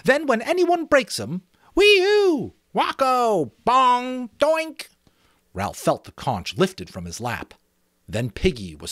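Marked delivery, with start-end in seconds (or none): 0.0-1.4 much higher, louder eager shout; excited cry. Acted. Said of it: "he cried excitedly". 1.7-4.9 much higher, louder cartoon whoops and bonks; silly punishment noises. Jack mimics punishment noises.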